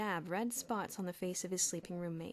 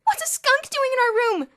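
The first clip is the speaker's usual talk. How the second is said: panicked; 14 dB louder than usual. A narrator's reading. Elmer panics at the skunk.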